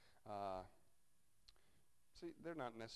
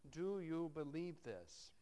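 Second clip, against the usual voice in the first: slow searching question; 4 semitones higher, slower than usual. Acted. Jesus asks the question, slowed.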